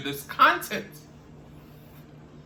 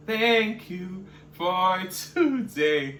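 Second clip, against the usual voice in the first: slow drawn-out thanks; 2 semitones higher, much slower than usual. Real, not acted. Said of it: "drawn-out sign-off thanks".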